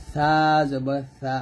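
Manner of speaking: সা drawn out with tongue tip on upper teeth edge; upper-teeth articulation. Shown as a demonstration. Teacher correcting tongue placement, সা slow and dental. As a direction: stretch a thin সা off the upper teeth, then short. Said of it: "উপরে দাঁতের আগা লাগাবেন".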